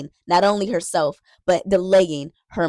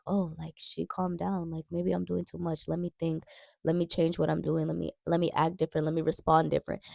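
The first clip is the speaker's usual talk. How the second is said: softer, reflective voicing of a wise boyfriend; calm wise man; 7 dB quieter than usual. Wise boyfriend reflecting to himself, softly and calmly. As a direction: muse it quietly like a man rethinking. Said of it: "other men who are wise will be like".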